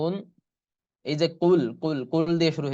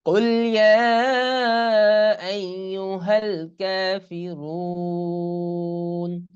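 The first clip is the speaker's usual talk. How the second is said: slow melodic tajweed chanting; Quran recitation; 5 semitones higher, 5 dB louder, much slower than usual. Shown as a demonstration. Teacher reciting the surah for students, slow chant. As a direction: draw the vowels out in recitation.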